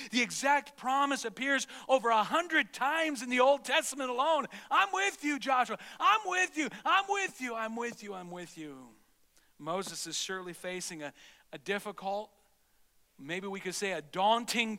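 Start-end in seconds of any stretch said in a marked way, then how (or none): 4.7-8.9 building then fading. Acted. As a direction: call it out high, then let it die away to a near hush. Preacher repeats God's promise.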